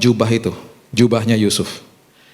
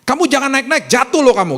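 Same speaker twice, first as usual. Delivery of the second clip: high-pitched mock scolding; sharp, high nagging; cursing parental warning; 13 semitones higher than usual. Shown as a demonstration. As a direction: imitate a parent predicting disaster. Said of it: "Kita nyumpahin dia".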